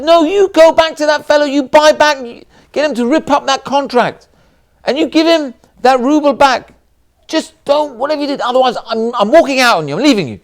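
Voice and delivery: high, loud, insistent demand; furious ultimatum. Retold or imitated. Wife ordering husband to buy back the contract, angry ultimatum. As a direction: order him to undo it or else.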